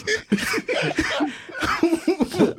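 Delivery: high burst of laughter. Real, not acted. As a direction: crack up laughing hard.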